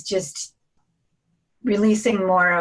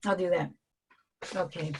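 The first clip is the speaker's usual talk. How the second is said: ordinary talk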